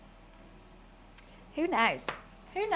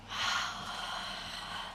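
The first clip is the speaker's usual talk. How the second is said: sigh; 9 dB quieter than usual. Real, not acted. Dismay over ruined top.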